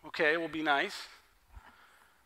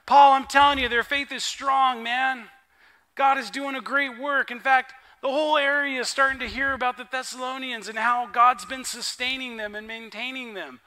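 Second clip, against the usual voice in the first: loud, high, eager news; Timothy loudly telling Paul the church stands strong; excited report; 4 semitones higher, 7 dB louder than usual. Acted. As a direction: burst in with the good news. Timothy brings Paul good news.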